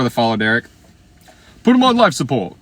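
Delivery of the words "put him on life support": much higher, louder comic squeal; hammed-up squeal; squealing joke. Real, not acted. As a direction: shriek it like a punchline.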